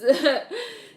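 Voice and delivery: high bursting laugh, nearly crying with it; a loud real laugh that cracks up high. Real, not acted. Laughing at her own story about religious grandparents, a loud high burst of laughter.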